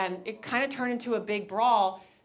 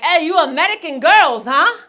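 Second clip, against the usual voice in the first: very loud, higher mock German guy; German guys hitting on them; 6 semitones higher, 16 dB louder than usual. German guys to the girls, loud mock accent. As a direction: chat them up, loud and bold. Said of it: "they were like".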